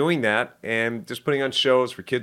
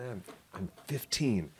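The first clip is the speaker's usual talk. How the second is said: put-on much softer, small voice of a kid owning up; sheepish admission; 3 semitones lower, 13 dB quieter than usual. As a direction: mumble it like a caught kid. Admitting age sheepishly.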